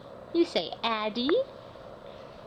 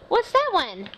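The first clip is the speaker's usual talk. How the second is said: gasp, then a higher, louder question; excited surprise; 8 semitones higher, 4 dB louder than usual. Acted. Mother points at a picture for her toddler, excited surprise. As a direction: sound amazed to spark the child's interest.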